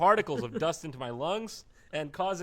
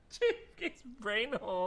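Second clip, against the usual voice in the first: laughing; 6 semitones higher, 4 dB quieter than usual. Real, not acted. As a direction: laugh through the phrase. Laughing repeat.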